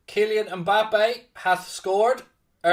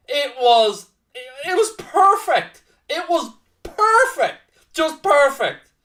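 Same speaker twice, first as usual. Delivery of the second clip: high, straining voice of disbelief; high-pitched, loud gushing that starts to ease; overwhelmed delight; 8 semitones higher, 5 dB louder than usual. Real, not acted. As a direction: squeal the praise in awe. Overcome by the perfect goal.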